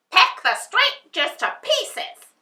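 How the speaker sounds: high loud snapping witch. A narrator's reading. Witch orders the crows, high and loud. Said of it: "she snapped".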